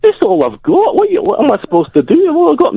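very high pleading protest; mock indignation. Diner with only Scottish notes pleading, mock indignation.

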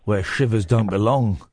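very low, slow spooky voice; deep mock horror voice.

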